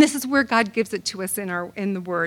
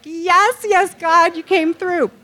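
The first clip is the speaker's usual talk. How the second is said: jubilant; 6 semitones higher, 4 dB louder, slower than usual. Retold or imitated. Surveyor confirms, she rejoices.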